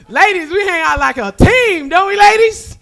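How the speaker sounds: high, loud call to the crowd; rallying crowd call. Real, not acted. Comic calling out to the ladies in the crowd, loud and high. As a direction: whip the ladies up.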